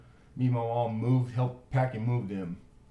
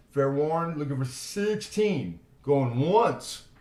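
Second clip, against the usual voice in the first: auctioneer call; 4 semitones higher, 4 dB louder, slower than usual. Acted. Auctioneer calling last bids.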